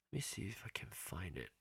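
fast unvoiced whisper to self. Real, not acted. Host whispering to herself while hunting for the message. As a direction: mutter it to yourself while searching. Searching messages, under breath.

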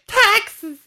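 Taxes said way up high and silly; high silly try. Shown as a demonstration. Testing the word for listeners, high silly Taxes. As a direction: squeak out Taxes.

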